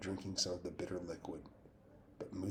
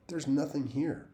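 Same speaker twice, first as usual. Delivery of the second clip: put-on a boy's rasp, louder and higher; 7 semitones higher, 6 dB louder than usual. A narrator's reading. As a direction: rasp it out like a nervous boy in the dark. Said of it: "in a rasping voice I didn't recognize".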